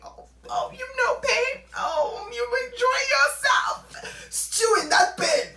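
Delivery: high, loud mock sobbing and jeering; mock crying taunt. Gloating at her crying ex, high mock sobs and jeers. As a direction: wail and taunt like a gloating villain. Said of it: "it was so funny".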